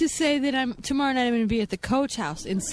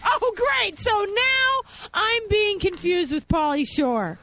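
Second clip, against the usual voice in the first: high, drawn-out theatrical complaint; mock exasperation; 8 semitones higher, much slower than usual. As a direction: play the affronted diva, pitched way up. Guest mock-offended at mistaken identity.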